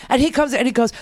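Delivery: ordinary talk, nothing marked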